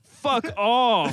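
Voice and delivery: high, loud disbelieving shout; outraged yell. Real, not acted. Reacting to AC/DC.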